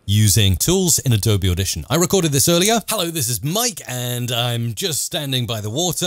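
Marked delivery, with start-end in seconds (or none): none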